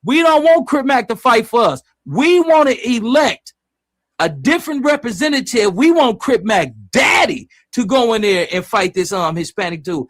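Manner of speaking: loud, high and fired up, riffing on the chat; going off on a passionate rant for the stream; heated rant. Real, not acted. Heated rant about C-Mac's father.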